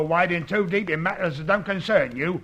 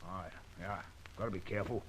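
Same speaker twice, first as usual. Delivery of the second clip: hushed; 10 semitones lower, 20 dB quieter, faster than usual. Acted. Warned to be careful, hushed.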